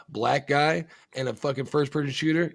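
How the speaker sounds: ordinary talk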